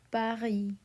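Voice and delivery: Paris said pa-REE with a French R and silent S. Shown as a demonstration. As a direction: drop the S in Paris. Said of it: "We say".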